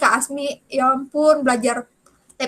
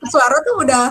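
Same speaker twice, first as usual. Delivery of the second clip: usual speaking voice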